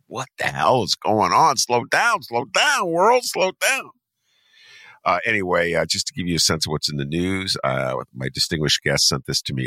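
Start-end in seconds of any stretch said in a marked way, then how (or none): 0.1-3.9 rising to a high-pitched cry; mock exasperated plea. Host begging the world to slow down, mock exasperated. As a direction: plead with the world to slow down, voice climbing.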